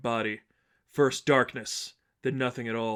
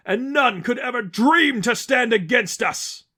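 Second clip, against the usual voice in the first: zealous rant; 7 semitones higher, 6 dB louder than usual. Acted. Lester ranting to the heroes about God, high and loud. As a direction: rant like a fanatic proclaiming victory. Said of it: "In the midst of his rant".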